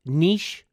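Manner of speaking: niche as "neesh"; newer pronunciation. Shown as a demonstration. Host compares it to Kyiv, niche said "neesh". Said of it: "sort of like the word".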